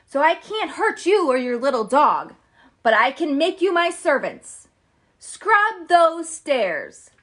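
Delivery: loud cackling witch command; wicked witch voice. Acted. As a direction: bark orders like a witch. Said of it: "cried the Wicked Witch".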